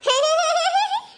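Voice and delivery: high, loud burst of laughter; gleeful kid laugh. Acted. Son bursts out laughing at his father's joke about mother.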